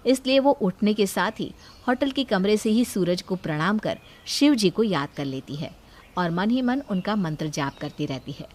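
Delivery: usual speaking voice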